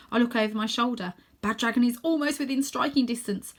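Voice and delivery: plain reading voice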